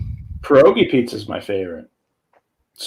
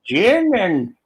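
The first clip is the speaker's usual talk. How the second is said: put-on slow, high, boozy older woman; drunk cougar voice; 10 semitones higher, 3 dB louder, slower than usual. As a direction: drawl it like a tipsy cougar.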